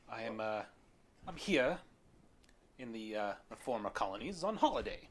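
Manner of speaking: halting British gentleman, rising at the end; eccentric British lord. Acted. Lord explains his holiday and questions strangers, halting and British. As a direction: fumble politely, then ask pointedly.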